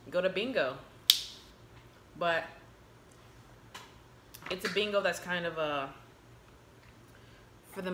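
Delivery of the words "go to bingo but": talking between bites; chewing while talking. Real, not acted. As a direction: mouth partly full. Seen 0: Ate crab at -1.0; mouth closed, chewing while peeling, hand to mouth.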